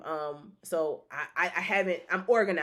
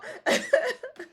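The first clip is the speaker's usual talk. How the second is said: high laugh. Real, not acted. Joking with viewers.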